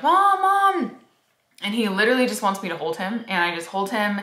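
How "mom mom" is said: put-on high little-boy voice calling Mom; imitating toddler son. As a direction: mimic a sleepy toddler crying for mom. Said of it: "he goes like".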